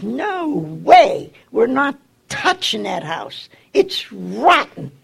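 high, loud, slow angry retort; angry refusal. Retold or imitated. Contractor refusing to touch the rotten house, loud angry refusal. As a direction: refuse flatly and loudly. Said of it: "And he was angry".

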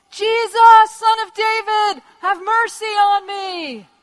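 high, loud, drawn-out plea; desperate shout. Acted. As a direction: cry out over a crowd for mercy. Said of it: "starts shouting out at the top of his voice".

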